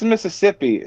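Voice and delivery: ordinary delivery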